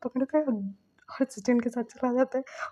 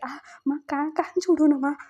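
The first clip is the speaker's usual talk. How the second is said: ow, let go of my ear, pleading louder; pained plea; 4 semitones higher, 5 dB louder than usual. Retold or imitated. Son begging mother to release his ear, pained whine. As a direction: yelp as the ear gets twisted.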